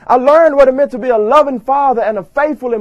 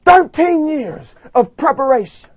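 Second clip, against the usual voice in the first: normal talking voice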